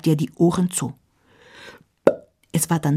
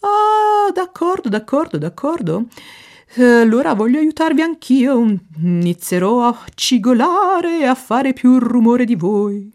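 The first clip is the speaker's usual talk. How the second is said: high, loud and quick, giving in eagerly; a dramatic lady deciding to join in; excitable Italian window; 7 semitones higher, 6 dB louder than usual. Acted. Fenster decides to squeak along, high eager voice.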